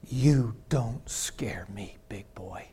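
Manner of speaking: slow whispered taunt; quiet slow whispered dare; defiant whisper. Retold or imitated. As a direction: hiss it low and slow, unafraid. Defiant reply to hostile pastor.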